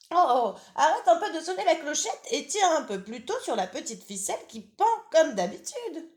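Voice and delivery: high, loud impatient granny voice; old grandmother. Acted. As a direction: nag like a grumpy old granny. Grandmother scolds gently.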